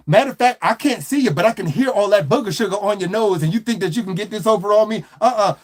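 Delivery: ordinary talk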